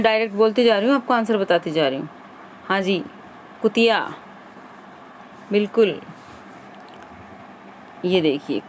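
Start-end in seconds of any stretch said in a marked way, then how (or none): none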